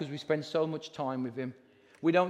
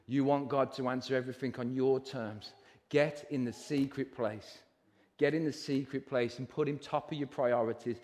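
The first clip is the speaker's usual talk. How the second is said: ordinary delivery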